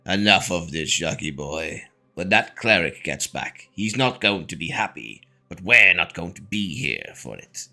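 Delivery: low, slow, deliberate villain voice; Saucy Son. Acted. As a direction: pronounce it gravely in a low, measured voice. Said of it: "Saucy Son pipes up and he's like".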